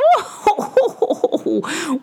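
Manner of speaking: high bright chuckle; witch laugh. Acted. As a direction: laugh out warmly. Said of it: "made the witch witch laugh".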